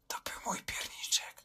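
very soft breathy whisper. Retold or imitated. Boy whispering to Julka, lips against her cheek, soft whisper. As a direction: whisper "To był mój pierniczek" close to her cheek. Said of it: "cicho wyszeptałem".

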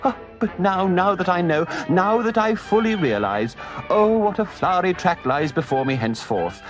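high, swooning ecstatic toad; rapture. Acted. Toad rhapsodizing to his friends, ecstatic. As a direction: gush over the joy of motoring. Toad raving about motoring.

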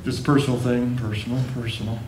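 mock a dad muttering it's private; mumbling dad. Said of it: "they see dad going".

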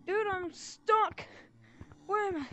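high, loud panicked yell; being chased. Real, not acted. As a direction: cry out, cornered and frantic. Trapped in maze, being chased.

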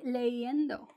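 "leyendo" said as le-i-endo; vowel i, no Y. Shown as a demonstration. Teacher showing the strange form, "leyendo" as le-i-endo. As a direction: run the vowels of "leyendo" together, without a Y consonant. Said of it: "Would be very strange to say".

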